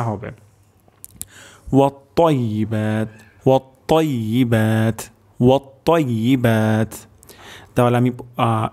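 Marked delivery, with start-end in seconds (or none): 1.7-6.9 ওয়াত্তাইয়িবাত with heavy t, long final vowel; thick ta. Shown as a demonstration. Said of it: "তর উচ্চারণটি মোটা".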